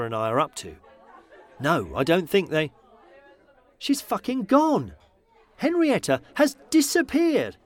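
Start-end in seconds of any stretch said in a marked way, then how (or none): 3.8-7.6 shocked realisation. Acted. Sudden shocked discovery.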